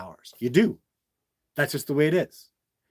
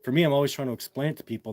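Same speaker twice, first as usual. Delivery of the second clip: ordinary delivery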